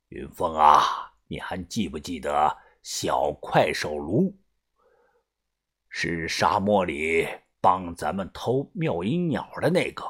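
low, slow, hushed elder; old gang boss. Acted. Boss reminding Yunfeng of an old helper, low and slow.

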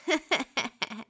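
soft, mocking wolf snicker; wolf's sly laugh. A narrator's reading. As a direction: chuckle slyly like a scheming wolf. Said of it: "狼笑着说".

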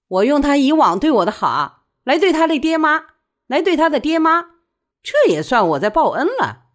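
loud, high, insistent self-defence; defiant. A narrator's reading. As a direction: argue it out forcefully. Widow defends herself in quarrel.

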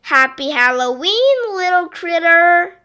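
loud, high cheerful surprise shout; group shout. Acted. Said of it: "say all my".

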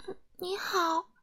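soft, high, tiny voice; timid small voice. A narrator's reading. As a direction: speak up very quietly and meekly. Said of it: "安静先生小声地说". (又轻又细地小声说)

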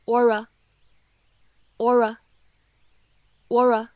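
or a linked into aura, said slowly; slow linked demo. Shown as a demonstration. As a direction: blend or a into one word aura. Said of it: "可以读成". (or a 连读成 aura)

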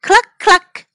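high, loud clucks; hen clucking. Acted. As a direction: peck it out like a hen. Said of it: "went the hen".

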